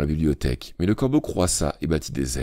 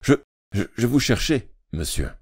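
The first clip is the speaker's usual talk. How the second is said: put-on stammering boy; 6 semitones higher than usual. A narrator's reading.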